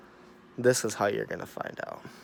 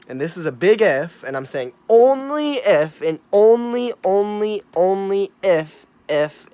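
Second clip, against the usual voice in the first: "only" and "if" hammered out louder and higher, word by word; emphasizing only if; 7 semitones higher, 9 dB louder than usual. Real, not acted. Warning his viewers about the virus, stressing "only if" loudly. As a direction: pound every "only" like a fist on the table. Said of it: "I gotta emphasize this".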